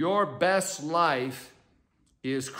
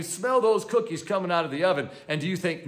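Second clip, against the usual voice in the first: ordinary talk, nothing marked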